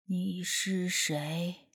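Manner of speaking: low, slow, hushed and heavy; a ghost speaking through a boy, very slow; spirit-possessed voice. Acted. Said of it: "他的声音沉沉的，缓缓的".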